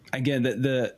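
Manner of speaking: ordinary talk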